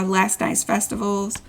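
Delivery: ordinary delivery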